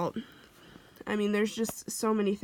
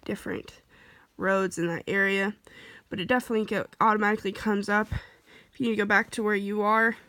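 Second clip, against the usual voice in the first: ordinary delivery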